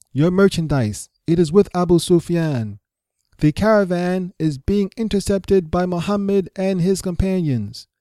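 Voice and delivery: high, drawn-out cry of alarm to a crowd; dramatic herald's yell, high and urgent; yelling out loudly. Acted. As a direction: call the town out for help, pitched well up. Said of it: "began to yell out loudly".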